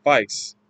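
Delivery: "vice" for face, rhymed with rice; Cockney "vice", A turned to a long I; Cockney A sound. Shown as a demonstration. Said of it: "like rice".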